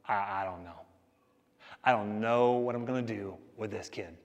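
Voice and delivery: low, soft, defeated sigh of a teacher at a loss; exasperated teacher. Retold or imitated. Teacher at wit's end over one kid, low and soft. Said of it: "you just throw your hands up and you go".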